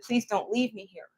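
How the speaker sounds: soft, quiet begging, imitating himself on his knees; a hushed plea, mildly acted; retold pleading. Man on his knees begging the officer not to leave, quiet plea. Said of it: "I actually fell on my knees and said".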